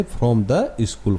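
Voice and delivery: very slow, each word drawn out; slow read-aloud. Shown as a demonstration. Teacher reading the phrase to students, very slow. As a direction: stretch every word of From the school. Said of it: "कहाँ से".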